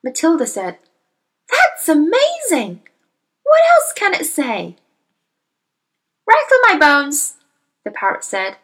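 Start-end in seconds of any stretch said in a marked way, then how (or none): 1.5-4.8 excited. Acted. Matilda excited by talking parrot. 6.3-7.4 high squawking parrot, mock spooky; a parrot playing at ghostly. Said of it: "giving a wonderful imitation of a spooky voice".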